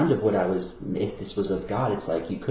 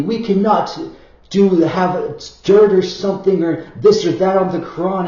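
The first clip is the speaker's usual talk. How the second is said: imitating a strict believer, pitched up and louder; hammed-up pious objection, raised and pushed; 8 semitones higher, 8 dB louder than usual. Mocking Muslims who guard the Quran, in a raised, loud voice. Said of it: "they're like, literally".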